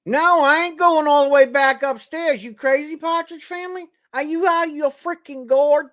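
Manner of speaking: high, loud, fed-up shout at the family; exasperated yell. Real, not acted. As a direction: holler back that you're not climbing those stairs. Yelling at the Partridge Family.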